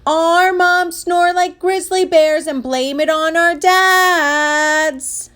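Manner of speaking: high, slow, chanting shout; kids' loud, drawn-out announcement; public yell. Acted. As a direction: holler it for everyone to hear, stretching the last word. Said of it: "and yelled".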